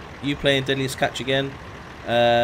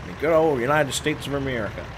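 bad put-on American accent, hammed up; terrible American accent; 2 semitones higher than usual. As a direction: lay on a cheesy American accent. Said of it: "That's a terrible accent".

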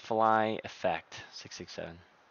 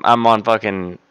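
ordinary delivery

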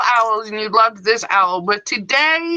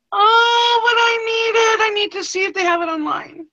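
excited longing; 9 semitones higher than usual. Real, not acted. Shopper who needs to find it online, excited longing.

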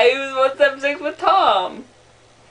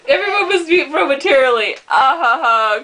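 normal talking voice

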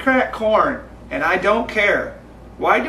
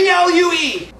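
fast; 10 semitones higher than usual. Pretend. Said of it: "in less than seven seconds".

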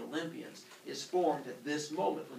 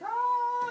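loud, high drawn-out "ah"; comic scream into the void; falling scream; 7 dB louder than usual. Acted. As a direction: wail high while floating away. Uranus falling into space.

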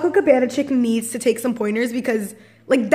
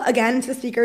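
ordinary talk

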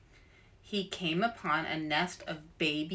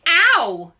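yelp of pain; 9 semitones higher, 13 dB louder than usual. Acted. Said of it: "said Arnold, grabbing his nose".

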